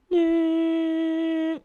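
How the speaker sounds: long, loud, high steady beep; hospital flatline beep. Shown as a demonstration. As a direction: imitate a heart monitor flatlining. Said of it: "what you don't want to hear at the hospital".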